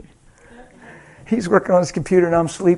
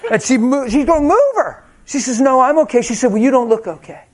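excited retelling; 8 semitones higher, 3 dB louder than usual. Retold or imitated. Retelling flight attendant scene.

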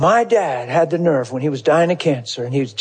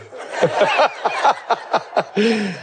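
rolling, gleeful laughter; long high laugh; 7 semitones higher than usual. Real, not acted. Telling the audience the story, long gleeful laughter. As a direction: laugh until it trails off.

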